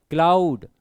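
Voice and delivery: Cloud loud and held; stressed syllable. Shown as a demonstration. Teacher showing a stressed word, cloud said loudly and long. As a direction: push on cloud with extra force. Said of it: "ये stressed हो जाएगा".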